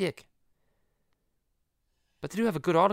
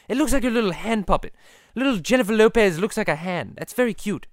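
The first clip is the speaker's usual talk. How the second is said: higher, fussy cartoon host voice; Stewie; 4 semitones higher, 4 dB louder than usual. Stewie warning the puppet guest to behave, high cartoon voice. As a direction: play the cartoon host handling an unruly guest. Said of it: "the Stewie and Peter podcast".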